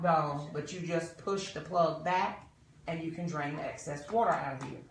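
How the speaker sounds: normal talking voice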